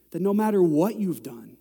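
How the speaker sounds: ordinary talk, nothing marked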